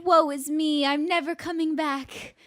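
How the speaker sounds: high, mock dramatic sulking princess; cartoon princess. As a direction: wail it like a swooning Disney princess. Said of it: "Like a Disney princess when they throw themselves on the bed and they cry".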